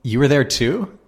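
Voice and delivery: shocked you were there question; astonished disbelief. Retold or imitated. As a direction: blurt it out, stunned that he saw it too. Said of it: "And I say".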